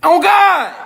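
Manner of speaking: high, loud outcry; exasperated cry. Acted. Comic fed up with [name], loud exasperated cry.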